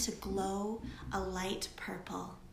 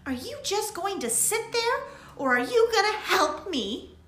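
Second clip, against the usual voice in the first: higher, louder impatient demand; impatient Lily; 11 semitones higher, 6 dB louder than usual. A narrator's reading. Lily demanding Daisy help her, impatient. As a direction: snap at your idle friend.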